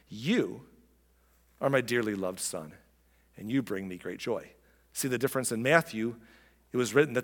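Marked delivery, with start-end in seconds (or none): 0.1-4.5 low, soft address to a beloved son; tender and solemn, pausing after You; voice of heaven. Acted. God to Jesus at his baptism, tenderly solemn.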